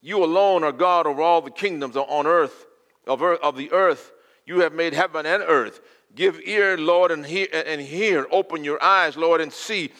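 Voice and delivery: loud, high preached prayer; preaching prayer. Acted. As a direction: pray it out at full force to the Lord.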